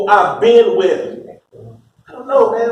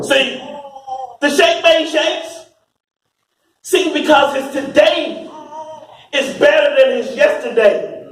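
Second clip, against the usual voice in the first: raised, driving preacher's cadence; fiery preaching; 6 semitones higher than usual. Acted. Preacher to the congregation about Sona Ida, fiery preaching. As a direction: preach it full force to the room. Preacher recounts sheikhs' betrayal.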